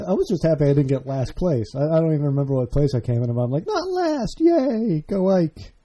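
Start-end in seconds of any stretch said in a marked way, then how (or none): 3.7-5.7 mock cheer.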